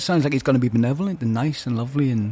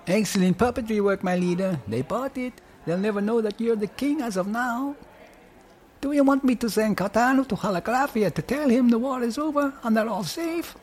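put-on higher, lilting Welsh accent; 8 semitones higher than usual. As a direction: speak it with a Welsh lilt. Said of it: "in his Welsh accent".

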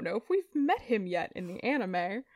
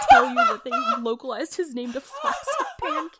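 higher and louder, dissolving into laughter; laughing hard; 8 dB louder, faster than usual. Real, not acted. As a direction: push the words through a fit of giggles. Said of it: "I'm crying".

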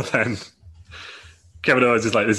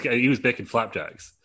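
normal talking voice

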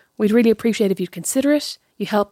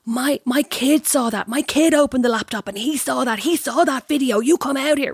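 retold angry neighbour; 4 semitones higher, slower than usual. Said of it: "he was shouting".

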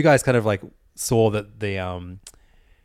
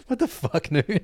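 high, softer protest, half-laughing; mock disbelief; 7 semitones higher, 4 dB quieter, slower than usual. Snubbed friend questions Noon about the missing seat, mock disbelief. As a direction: retell your baffled comeback with a high-pitched start. Acted outrage at friend's snub.

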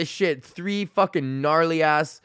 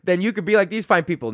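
usual speaking voice

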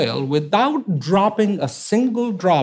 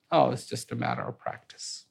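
softer, offhand remark; soft dismissive aside; 5 semitones lower, 10 dB quieter than usual. Acted. Oil seller murmuring from the crowd, soft and offhand. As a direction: drop the voice, shrugging it off. Background voice muttering.

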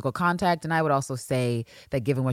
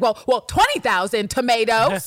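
higher, louder showy boast; playful, raised-voice one-upping; mock boasting; 7 semitones higher, 6 dB louder than usual. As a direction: announce it as if to impress. Acts Villanelle's boast.

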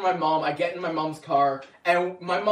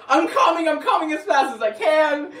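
much higher, louder, laughing; flustered; 10 semitones higher, 7 dB louder than usual. Retold or imitated. Answering leaders' calls while late, flustered and laughing. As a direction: rush the words out, harried.

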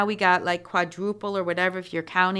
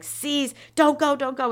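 dramatic plea; 8 semitones higher than usual. Acted. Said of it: "he just got all dramatic".

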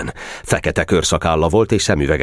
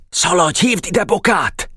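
put-on high, hushed-urgent boy's command; pitched-up urgent errand; urgent order; 14 semitones higher than usual. A narrator's reading. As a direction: send a friend running for help. Boy urgently sends a friend.

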